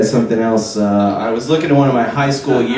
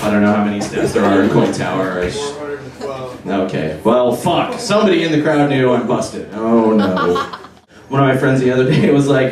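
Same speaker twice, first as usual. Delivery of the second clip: normal talking voice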